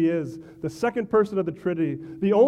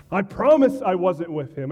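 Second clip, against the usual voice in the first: louder, insistent swearing; a cornered man swearing louder; heated oath; 3 semitones higher, 7 dB louder than usual. Acted. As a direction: swear it hard to get them off his back.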